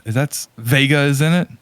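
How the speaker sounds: ordinary talk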